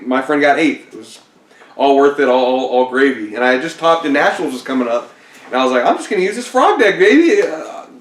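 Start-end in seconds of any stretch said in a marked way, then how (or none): 5.8-7.9 much higher, hammed-up boastful voice, slowing at the end; cocky. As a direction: play the smug big shot who thinks the game is easy now. Cocky self after back-to-back tops.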